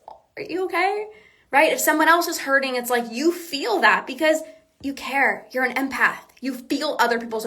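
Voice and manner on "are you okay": high-pitched, tentative check-in; put-on worried tenderness; acted concern. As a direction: ask carefully, voice lifted at the end. Acts out an empath's concern.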